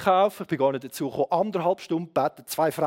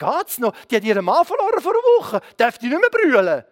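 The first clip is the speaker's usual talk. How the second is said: high-pitched outraged challenge; indignant telling-off; 4 semitones higher, 4 dB louder than usual. Retold or imitated. Wife telling off two hospital doctors, indignant. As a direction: act out an incredulous scolding, rising higher. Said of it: "zwei Ärzte zusammengeschissen".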